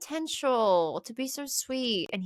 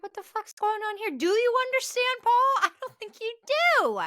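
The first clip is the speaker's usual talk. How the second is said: high, loud mock outrage; outraged girl; 11 semitones higher, 4 dB louder, faster than usual. Voicing the girl confronting Paul, high shocked outrage. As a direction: squeal it like a scandalised girl. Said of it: "It's like".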